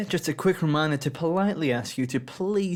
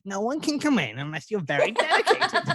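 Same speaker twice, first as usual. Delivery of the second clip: Devil Wears Prada; 6 semitones higher than usual. Retold or imitated. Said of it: "like Devil Wears Prada".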